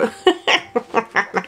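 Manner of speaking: evil witch laugh, gleeful and scheming; machiavellian witch cackle. Pretend. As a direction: cackle like a villain rubbing her hands. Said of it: "ce rire machiavélique de sorcière".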